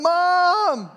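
high, loud frantic calls; a small child shouting for mom in panic; calling for mom. Retold or imitated. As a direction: yell for your lost mother across the store. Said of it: "crying out".

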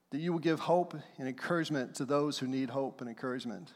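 soft, low prayer voice. Acted. Pastor praying softly over the church, low and hushed. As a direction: pray quietly over the congregation.

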